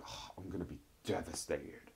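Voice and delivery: hushed, low mock heartbreak; mock devastated. Imitating him dreading her week away, mock devastated. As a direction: drop to a soft, put-on devastation. Retelling a man's forlorn reaction.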